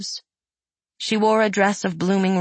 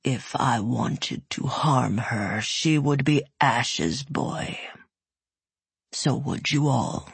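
low, slow menacing rumble; a huge beast speaking calmly; dragon voice; 4 semitones lower, slower than usual. Acted. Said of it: "Its voice was a low rumble".

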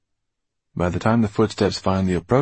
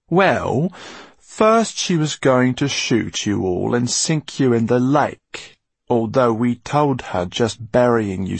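higher villain voice, relishing the threat; gloating Basta; 5 semitones higher, 3 dB louder than usual. A narrator's reading. Basta only too willing to tell Eleanor the plans, gloating. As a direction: taunt the captives in a raised, pointed voice.

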